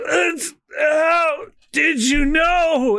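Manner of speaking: drawn-out stunned wail; slow shocked cry. Acted. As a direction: cry out in disbelief. Villain cries out.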